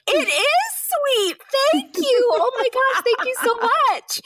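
very high, sugary gushing thank-you, slow at first; gushing sweetly. Retold or imitated. Flattered author gushing thanks at the publishers on the call, high and sugary. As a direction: play the naive flattered author, all sweetness.

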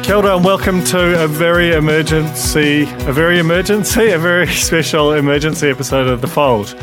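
bursting with excitement at the news; excited. Real, not acted. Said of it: "I'm a little bit excited".

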